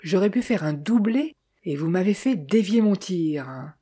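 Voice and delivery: ordinary delivery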